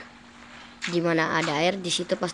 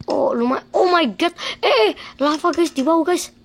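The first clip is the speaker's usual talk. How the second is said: alarmed shout; 10 semitones higher, 6 dB louder than usual. Acted. Sees lava below.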